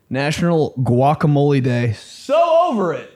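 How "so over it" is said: high, exasperated outburst; exasperated whine. Pretend. Comic ranting about Guacamole Day, exasperated whine.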